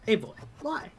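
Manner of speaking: cute Eevee voice. Pretend. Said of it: "It's fun to be an Eevee".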